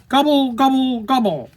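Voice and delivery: three high, quick gobbles; turkey gobble. Acted. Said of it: "The turkey says".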